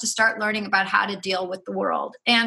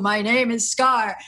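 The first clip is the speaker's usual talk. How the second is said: slow, lightly put-on villain lion voice; drawn-out mock Scar voice; Scar impression; slower than usual. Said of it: "I can't even do that accent".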